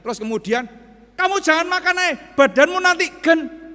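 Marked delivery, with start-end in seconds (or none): 1.1-3.6 high, loud, quick warning; nagging mother. Retold or imitated. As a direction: warn the child off food. Mother warning child about eating. (ibu mengomel cepat, keras dan tinggi)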